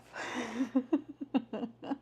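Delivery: real giggle at one's own silliness; high, helpless self-amused laugh; laughing at oneself. Real, not acted. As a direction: crack up at yourself. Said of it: "laughing at myself".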